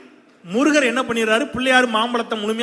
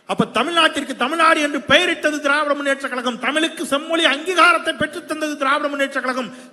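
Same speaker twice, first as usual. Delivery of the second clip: high, loud rally proclamation; full-force political oratory; 8 semitones higher, 6 dB louder than usual. Real, not acted. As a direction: ring out each achievement to the crowd. (உச்சக் குரலில் அரசியல் மேடை முழக்கம்)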